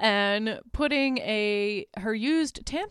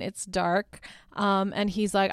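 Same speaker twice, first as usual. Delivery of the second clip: ordinary talk, nothing marked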